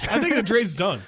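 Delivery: high, loud, put-on official voice; mock official announcement. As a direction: declare it like a front office boss. Jokingly closing a trade.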